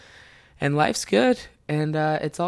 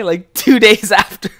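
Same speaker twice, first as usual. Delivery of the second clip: usual speaking voice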